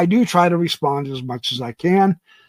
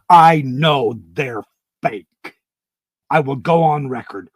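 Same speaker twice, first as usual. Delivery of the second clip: emphatic; 4 dB louder than usual. Real, not acted. Host answers a doubter about the pictures, emphatic. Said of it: "I know I'm getting emphatic".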